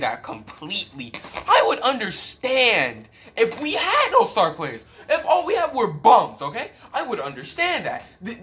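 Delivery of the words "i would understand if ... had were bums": exasperated laugh. Real, not acted. Exasperated rant about his team.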